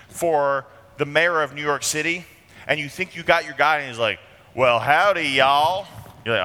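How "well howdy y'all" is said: put-on loud howdy in a Southern accent; Southern drawl. Supposed New York mayor greeting people, Southern accent. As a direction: put on a folksy Southern twang. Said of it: "Could be an accent thing".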